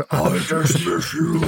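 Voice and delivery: slow mock-grand repeat through a laugh; laughing echo. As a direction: echo the decree amused.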